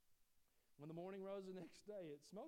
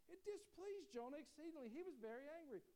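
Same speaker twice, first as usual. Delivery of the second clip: raised pitch, pressing the verse hard; emphatic retelling; 11 semitones higher than usual. Shown as a demonstration. Preacher hammering the verse at his congregation, high and emphatic. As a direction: lean into how angry Jonah was. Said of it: "we give Jonah a hard time for his attitude".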